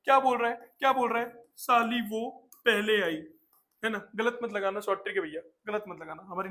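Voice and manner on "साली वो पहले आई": mock sobbing. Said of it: "रोते-रोते बोल रहा है".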